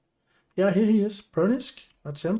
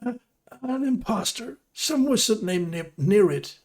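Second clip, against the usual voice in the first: high, urgent plea; frightened prisoner; 8 semitones higher than usual. Acted. Prisoner Pernisk pleading with his rescuer, high and urgent. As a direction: beg for help, voice pitched up.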